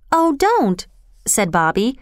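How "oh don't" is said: higher-pitched, urgent plea to stop; urging protest. A narrator's reading. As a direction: object on a girl's behalf, pitch raised. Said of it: "she urged the station master".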